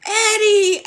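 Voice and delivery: very loud, high joyful call; joyful cry. Acted. Al seeing Eddie return, calling out in joy. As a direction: cry out his name with relief. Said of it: "Al cried".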